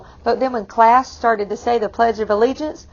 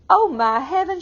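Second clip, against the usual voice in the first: mock alarmed cry; 7 semitones higher than usual. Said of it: "she cried".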